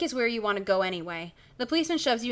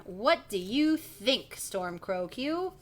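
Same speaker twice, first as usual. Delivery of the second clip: usual speaking voice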